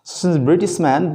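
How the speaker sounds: ordinary talk, nothing marked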